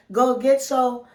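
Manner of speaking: ordinary delivery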